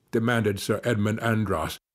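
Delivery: usual speaking voice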